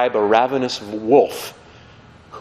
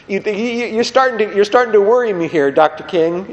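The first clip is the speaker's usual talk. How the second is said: worried listener; 7 semitones higher than usual. Acted. Acted worried listener to Doctor King.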